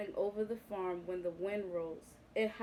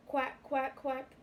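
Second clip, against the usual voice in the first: higher quacking duck call; duck quacks; 4 semitones higher, slower than usual. A narrator's reading. Ducks call out in the storm, quacking. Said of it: "they called".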